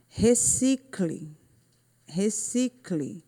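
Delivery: recicle drawn out slowly, syllable by syllable; slow syllable demonstration. Shown as a demonstration. Teacher sounds out recicle slowly for pupils hunting the tonic syllable. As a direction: stretch "recicle" so every syllable is heard. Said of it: "Sílaba tônica".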